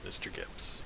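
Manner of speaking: whispered threat, barely any voice; an interrogator whispering menace at his captive; menacing whisper. Acted. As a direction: breathe his name as a quiet threat.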